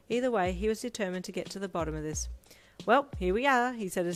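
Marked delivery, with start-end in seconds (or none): 2.8-3.7 upbeat. A narrator's reading. Character announces arrival.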